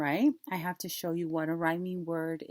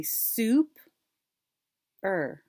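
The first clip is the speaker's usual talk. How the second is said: SOUP and ER as separate parts; split into syllables. Shown as a demonstration. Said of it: "We're gonna bring 'em together".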